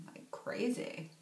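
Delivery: soft breathy hush on crazy; hushed aside. Real, not acted. To viewers, teasing about crazy recipes, hushed. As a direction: drop to a near whisper, teasing.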